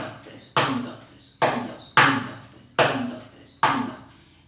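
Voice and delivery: whispered rhythmic count of uno dos tres; counting the beat. Shown as a demonstration. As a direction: count the subdivisions under your breath in time. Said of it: "El ritmo tres tres dos del uno".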